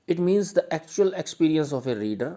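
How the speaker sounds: reading notes aloud slowly and loudly, word by word; slow dictation. Shown as a demonstration. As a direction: dictate it so students can write it down.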